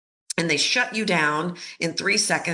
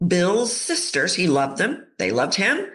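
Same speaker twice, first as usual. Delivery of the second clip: ordinary delivery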